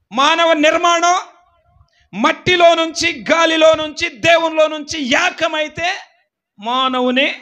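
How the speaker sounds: loud, high, shouted preaching; preacher building to a shouting climax; preaching at full force. Real, not acted. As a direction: drive each phrase home to the church.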